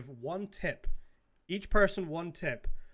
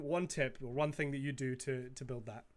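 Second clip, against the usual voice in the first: ordinary talk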